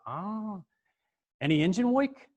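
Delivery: high, drawn-out New York "ah", then probing; pry like a New York mechanic; New York accent. Retold or imitated. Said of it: "this kinda New York accent".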